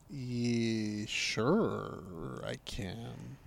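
soft, low grudging yes; barely committed reply; mock reluctant agreement. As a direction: shrug it off under your breath. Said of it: "I'm like".

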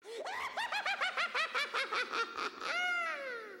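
high, fading burst of laughter; real laughing shriek. Real, not acted. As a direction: crack up at the gross twist. Reacting to nose falling off.

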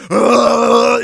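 guttural monster grunt; bear grunt. Retold or imitated. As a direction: grunt like a charging beast.

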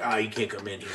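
low, soft imitation of the guard; mock quiet old guy. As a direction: mumble it like a dull doorman. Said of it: "they're like".

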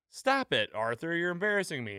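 high-pitched imitation of an embarrassed wife; Missus Hart. Embarrassed wife telling her husband to stop, imitated in a high voice. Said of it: "the Missus Hart, she's like".

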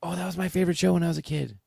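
quick, high gushing quote; gushing kids. Retold or imitated. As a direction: rattle it off like an excited fan.

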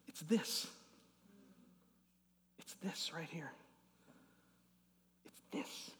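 soft whisper, barely voiced. Real, not acted. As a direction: whisper it tenderly as an embrace. Tender demonstration of embrace.

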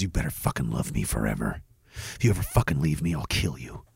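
a hushed, deep death threat from the partner; mock menacing girlfriend.

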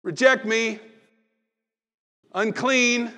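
loud high wailing call; mock leper crying out a warning; leper's holler. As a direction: cry out like an outcast leper. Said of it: "What they were required to holler?".

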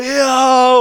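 high, loud drawn-out cheer; imitated crowd screaming. Crowd reacts to the found keys, screaming. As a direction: roar it like a jubilant audience. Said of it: "Og folk skriker".

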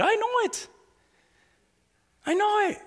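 soft, high, knowing voice; high-pitched aside. Real, not acted. Preacher teasing members who can't stand each other, high-pitched aside. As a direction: lift it high and keep it soft.

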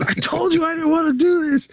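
high, lightly voiced whiny retort; a playful high whine; mock whine. Marcus excusing himself after the kick, a high mock whine. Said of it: "And Marcus goes".

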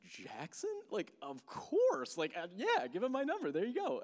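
high, excited retold yes; eager agreement. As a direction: stretch "of course" with delight. Delighted reply on hearing friend's name.

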